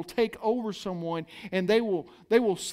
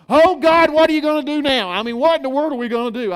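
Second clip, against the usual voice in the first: loud, higher desperate wail; panicked cry; 4 semitones higher, 9 dB louder, faster than usual. Retold or imitated. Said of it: "it probably went something like this".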